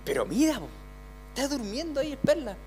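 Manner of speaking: a hint of fishermen plotting to wake him up; disciples urging. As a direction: play the disciples huddling to wake the master. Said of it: "todos se juntaron y dijeron".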